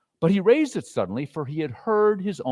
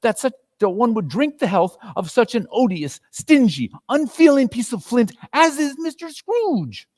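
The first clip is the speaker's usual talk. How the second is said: angry wife; 10 semitones higher than usual. Acted. Missus Cratchit fuming at Scrooge in front of Bob. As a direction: let the anger boil over.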